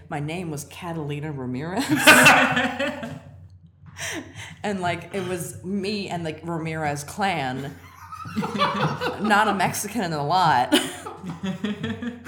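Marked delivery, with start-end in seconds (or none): 1.9-4.6 real laughing fit; loud high laugh. Real, not acted. Guest laughing at her fake name, loud and high. 7.8-9.1 high unvoiced laughter; wheezing real laugh; breathless laugh. Real, not acted. Guest laughing at the Ramirez clan, breathless. 9.2-12.3 louder, laughing through the words. Real, not acted. Guest laughing about the fake family, louder. Laughing while telling the story.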